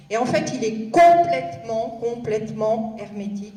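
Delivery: too loud into the microphone, easing off; accidental shouting. Real, not acted. Speaker unused to microphones addressing an audience, accidentally shouting. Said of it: "Excusez-moi, je crie".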